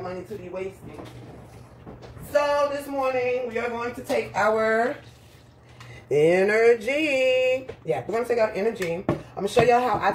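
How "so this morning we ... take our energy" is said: drawn-out announcement. Acted. Big announcement of her drink.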